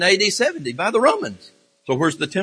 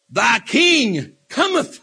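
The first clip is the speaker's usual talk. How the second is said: high, very drawn-out herald's cry; preacher heralding a king's arrival; exultant proclamation; 4 semitones higher, much slower than usual. Acted. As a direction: proclaim it slowly, stretching each word. Proclaims the coming king.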